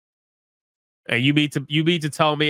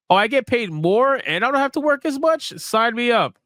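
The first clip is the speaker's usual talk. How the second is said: much higher, hammed-up eager job seeker; mock a naive recruit thrilled by the pitch; eager applicant; 9 semitones higher than usual.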